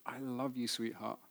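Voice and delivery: hushed, low-key loving words; tender retold endearment. Father to Olivia at breakfast, softly tender. Father declaring love to daughter.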